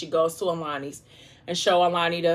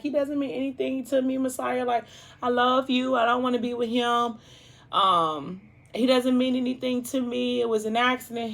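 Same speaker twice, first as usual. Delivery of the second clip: much higher, pleading voice; pleading girlfriend; 7 semitones higher than usual. Retold or imitated. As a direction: beg him to believe you, pitched up. Crying girlfriend pleads with boyfriend.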